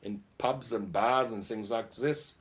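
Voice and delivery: hammed-up German accent butting into the interview. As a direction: play Helmut, a jolly Bavarian bandleader, with a German accent. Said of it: "Yeah, Helmut gets in the way".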